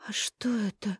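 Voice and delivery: soft, high, lost babble; a dazed whisper of disbelief; babbling. A narrator's reading. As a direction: stammer it out in shock. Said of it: "пролепетала Даша".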